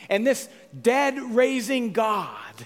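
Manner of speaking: loud, high, stretched-out words; emphatic proclamation. Real, not acted. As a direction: proclaim it slowly at full voice. Preacher proclaims God's power.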